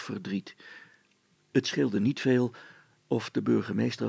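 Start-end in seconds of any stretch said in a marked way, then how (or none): none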